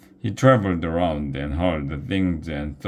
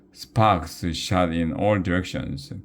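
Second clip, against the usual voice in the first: usual speaking voice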